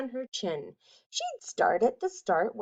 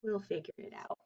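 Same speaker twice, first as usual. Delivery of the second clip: much softer, hushed reassurance; whispered; 3 semitones lower, 14 dB quieter, faster than usual. Acted. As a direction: murmur it as a gentle promise. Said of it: "They smiled and whispered".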